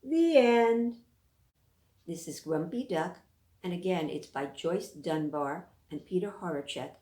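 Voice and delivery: usual speaking voice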